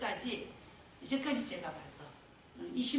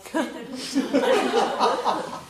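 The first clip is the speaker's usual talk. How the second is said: laughing; 5 semitones higher, 18 dB louder, slower than usual. Real, not acted. Laughing about easy kids.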